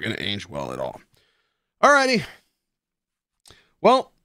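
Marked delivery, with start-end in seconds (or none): 1.8-4.1 upbeat sign-off. Real, not acted.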